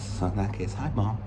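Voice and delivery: usual speaking voice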